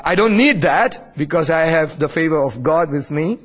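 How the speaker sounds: ordinary talk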